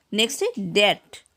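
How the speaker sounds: ordinary talk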